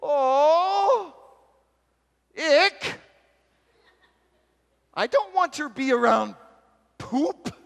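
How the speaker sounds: loud, high-pitched squeal of revulsion; hammed-up, high whining protest; whiny disgust. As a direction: wail it like a grossed-out complainer. Acted revulsion at helping the man.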